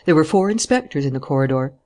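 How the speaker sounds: ordinary delivery, nothing marked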